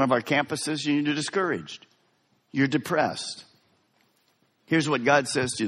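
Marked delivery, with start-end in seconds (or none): none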